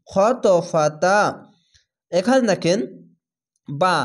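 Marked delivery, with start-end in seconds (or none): 0.1-1.2 slow recitation, the ত bounced upward before ফাতা; qalqala recitation. Shown as a demonstration. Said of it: "এই ধাক্কা মেরে ওপরে সাউন্ড যাচ্ছে".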